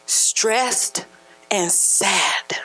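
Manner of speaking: a low, whispered "sad". Acted. Preacher naming the church's sadness, whispering. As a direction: let it fall to a whisper. Describing spiritual depression.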